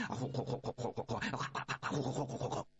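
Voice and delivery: low puffing whoosh of a fan; imitated fanning. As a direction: puff out the bellows noises. Boys fanning the furnace.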